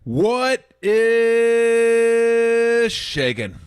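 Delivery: radio host greeting the listeners, stretched and high; drawn-out greeting. Real, not acted. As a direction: stretch it out to open the show.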